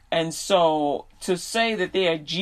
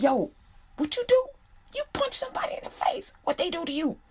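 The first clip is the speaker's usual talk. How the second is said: squeaky high mock dad voice, half whispered; high voiced dad; 9 semitones higher, 4 dB quieter than usual. The dad asking his son what happened, hushed and high-pitched. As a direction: play the dad leaning in, curious and hushed.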